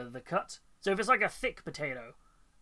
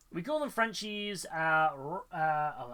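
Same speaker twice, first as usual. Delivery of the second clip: ordinary delivery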